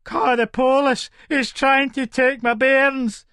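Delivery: high, drawn-out cry for help; desperate shouting. Acted. Mother shouting to neighbour about her bairns, desperate.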